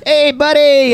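slap-on-the-back faux friendliness; mock buddy greeting. Greeting the unknown man like a pal, high and loud. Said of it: "Hey, guy".

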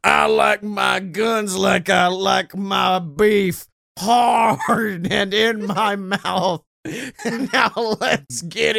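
big brash fake Texas accent, shouted. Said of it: "Put on this fake Texas accent".

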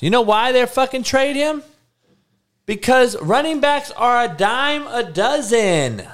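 high-pitched, heated rant; worked-up rant. Real, not acted. Ranting about trading a running back.

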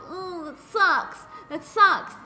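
high, drawn-out fake commiseration; mock negative sympathy. Host parodies commiserating over a swollen knee, mock sympathy. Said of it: "being so negative".

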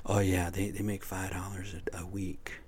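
sarcastic aside dropping to a whisper; mock whisper. Mocking how little prisoners earn, a sarcastic whisper. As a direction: sneer it under your breath.

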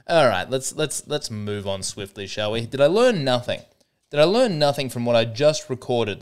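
ordinary delivery

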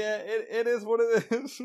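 imitating a deflated coworker shrugging it off; resigned shrug. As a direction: let it go with a tired, lifted sigh of acceptance. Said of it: "And Ben's just like".

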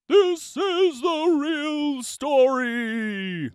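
slow, high, loud proclamation; drawn-out storyteller's announcement; dramatic announcement. A narrator's reading. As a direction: announce it like a grand title.

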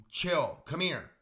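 high coaxing call to the dog. Real, not acted. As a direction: coax the dog over, voice up high. Talking to his dog Bauer.

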